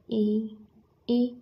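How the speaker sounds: a clear ई sounded with the front of the tongue; vowel demo. Shown as a demonstration. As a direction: say ई, ई to show the tongue tip working. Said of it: "जीभ है उसका आगे का भाग काम करेगा". (जीभ के आगे के भाग से ई का उच्चारण)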